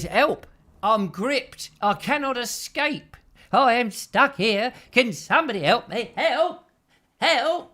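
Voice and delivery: high, loud hammed-up plea; mock cry for help. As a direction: call out in exaggerated, put-on desperation. Acting out viewer's mock plea.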